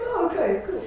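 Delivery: mock meek reply. Her own retold reply.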